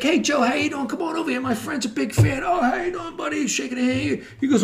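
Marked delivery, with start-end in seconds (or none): 0.0-2.4 high, eager call across the room; mock-eager shout to a hockey star; excited calling out. Calling the player over, excited. 2.4-3.4 high, jovial hello; lightly voiced friendly hockey player; hearty greeting.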